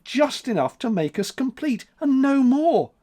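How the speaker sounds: high tearful lament; plead, near tears; sobbing. A narrator's reading. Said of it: "sobbed April".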